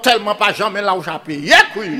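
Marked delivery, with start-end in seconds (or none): 1.5-2.0 loud high call to the crowd; rally the audience with a shout; storyteller's crowd call. Acted.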